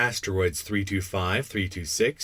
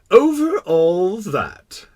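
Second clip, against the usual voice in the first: loud, very slow, higher pronouncement; assured king; 9 semitones higher, 9 dB louder, much slower than usual. Acted. King confirming his universal rule, majestic. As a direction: draw it out grandly as monarch. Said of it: "the king answered".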